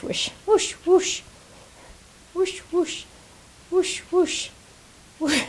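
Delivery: high playful whoosh sound effects, breathy and airy; a crafter whooshes along with her pen strokes; silly whoosh noises. Shown as a demonstration. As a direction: make a goofy whooshing noise with each stroke. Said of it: "I like to say whoosh".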